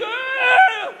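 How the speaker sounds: high, shouted mock fan yelling up at the screen; excited drunk fan. Comedian mocking upper-deck fans on the Jumbotron, mock drunk shout. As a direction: ham up a wasted stadium fan spotting himself.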